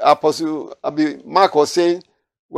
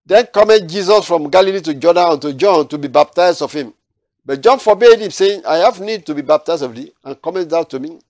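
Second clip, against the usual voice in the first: raised, solemn reading of the verse; scripture reading; 3 semitones higher, 5 dB louder than usual. Acted. Preacher reading the verse to his study group, solemn and raised. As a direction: proclaim the prophecy from the Bible.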